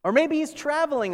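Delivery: high mock speculation; sarcastic taunt. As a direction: keep needling them with false sympathy. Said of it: "Elijah goes on".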